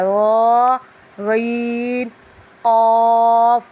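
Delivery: "غا، غین، قاف" thick and greatly drawn out; heavy letters. Shown as a demonstration. Teacher models the full letters, very slow heavy sound. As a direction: hold each of "غا، غین، قاف" long and full. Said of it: "ان حروفوں کو پر پڑھا".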